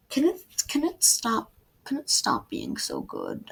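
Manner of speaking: reader pretending to be annoyed that the book is so good; mock complaint.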